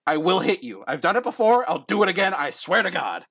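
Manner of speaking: exasperated judge, pitched high; worked-up, high-pitched warning; fed-up threat. Acted. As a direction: threaten them like you've had enough.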